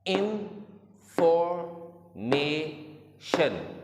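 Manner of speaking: Information said very slowly in four beats, इन-फॉर-मे-शन; teacher beating out information syllable by syllable. Shown as a demonstration. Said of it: "four syllable.".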